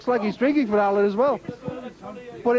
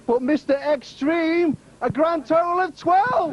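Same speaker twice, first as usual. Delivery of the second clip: grand score reveal; 5 semitones higher, slower than usual. Acted. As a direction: announce the total like a ring announcer. Announcing winning score.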